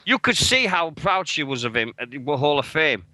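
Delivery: normal talking voice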